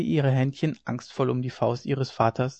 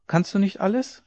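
lightly voiced child, high rising question; child's voice; 7 semitones higher than usual. A narrator's reading. Child questions the all-powerful father, lightly voiced child's voice. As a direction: ask as a small child would.

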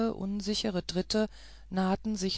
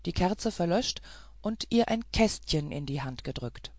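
usual speaking voice

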